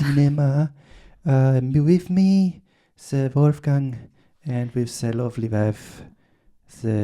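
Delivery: grand French host introduction; hammed French accent. Host introduces Sir Wolfgang and his wife in French accent. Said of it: "talking French with a French accent".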